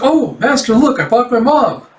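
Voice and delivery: high, loud, excited call; gleeful announcement. Acted. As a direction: sing out the news to your master. Pupil gleefully presents her mother.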